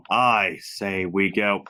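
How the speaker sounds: low, slow mock-hero line; solemn, drawn-out decision; ponderous Optimus. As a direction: take your time on every word, pitched low. Acting slow-deciding Optimus in car.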